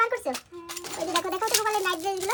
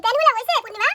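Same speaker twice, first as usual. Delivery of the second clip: raised voice, calling across the room; 6 semitones higher, 8 dB louder than usual. Real, not acted. Asking Purnima about Gopal's pants, called out loudly. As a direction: call out to her loudly from further away.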